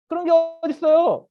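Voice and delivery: high, loud objection; indignant protest. Acted. Man told he cannot enter temple, indignant protest. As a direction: object as if wronged.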